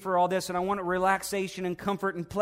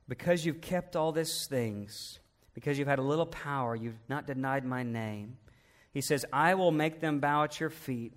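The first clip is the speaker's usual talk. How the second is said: tender divine voice; 6 semitones lower, 6 dB quieter than usual. Acted. God gently tells the faithful church He loves them: soft and hushed. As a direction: speak it like God whispering to a faithful child.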